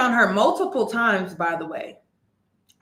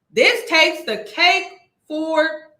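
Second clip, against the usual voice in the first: "This takes the cake" said higher and louder, slowly; incredulous emphasis; 5 semitones higher, 4 dB louder, slower than usual. Real, not acted. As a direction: push the disbelief on the repeat. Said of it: "This takes the cake for me".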